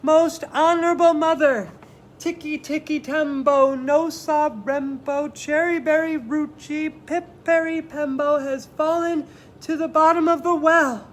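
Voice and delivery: very loud, high, slow and deliberate. Acted. Chang, out of breath, slowly shouts the name to his mother. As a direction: spell it out slowly to be heard over water. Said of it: "very slowly and loudly said".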